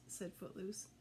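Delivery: usual speaking voice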